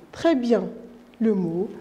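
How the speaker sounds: normal talking voice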